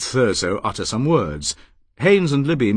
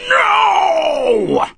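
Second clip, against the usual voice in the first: long, high, very loud cry; full-lunged shout; 7 dB louder than usual. Acted. Paris protesting at the slaves' fate, shouting with all his strength. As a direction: hurl it at the sky with all your lungs. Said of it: "With all the strength of his lungs".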